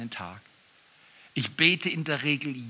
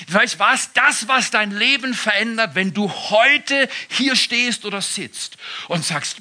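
usual speaking voice

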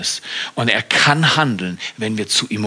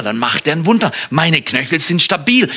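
ordinary talk

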